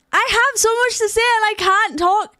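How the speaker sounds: high, loud burst of words; frustrated, animated outburst; excited streamer voice. Shown as a demonstration. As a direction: let it spill out with full energy. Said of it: "This is just how I talk on stream".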